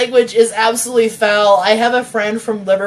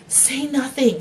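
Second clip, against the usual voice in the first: hissed; 4 semitones higher, 5 dB quieter, slower than usual. Acted. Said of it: "she hissed".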